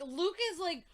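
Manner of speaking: normal talking voice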